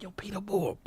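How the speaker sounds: soft whisper. Retold or imitated. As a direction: whisper his name. Tagging Peter Moore, whispered.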